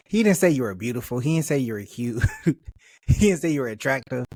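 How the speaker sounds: ordinary delivery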